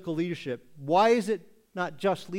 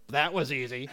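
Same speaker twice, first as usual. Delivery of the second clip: dry sarcastic delivery; mock how easy it was, sarcastically; 3 semitones lower than usual. Shown as a demonstration. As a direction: say it with obvious sarcasm. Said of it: "There's two ways to say this".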